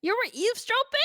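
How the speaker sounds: high, loud incredulous question; shocked question. Real, not acted. Guest learning the host listened in, shocked. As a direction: pitch jumping up in disbelief.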